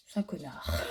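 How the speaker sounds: low, soft deadpan aside. Real, not acted. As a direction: drop it quietly as a joke. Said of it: "Non, non, c'est un bon chat". (aparté pince-sans-rire)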